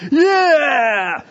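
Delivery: huge, high cheering yell with a laugh; cheering crowd. Retold or imitated. Preacher mimics winning fans, high cheering shout. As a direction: roar like a stadium of winning fans. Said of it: "forty thousand people instantly are going".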